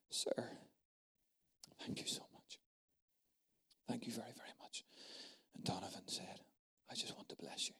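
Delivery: hushed near-whisper, barely voiced; quiet, secretive whisper of a private moment; whispered retelling. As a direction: lean in and whisper the exchange. Retelling a hushed table exchange.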